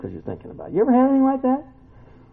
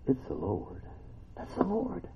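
hushed awe; 3 semitones lower, 17 dB quieter than usual. Retold or imitated. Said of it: "and John says".

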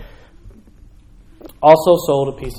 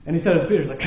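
ordinary talk, nothing marked

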